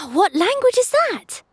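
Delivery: put-on high, slowed boy's voice full of wonder; a kid watching a magic trick; amazed boy. A narrator's reading. Obi amazed.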